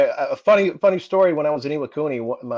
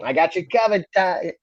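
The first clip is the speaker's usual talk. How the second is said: put-on raised-pitch Boston accent; Top McGrath; 6 semitones higher than usual. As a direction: imitate a Boston sergeant's catchphrase. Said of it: "you got that accent down".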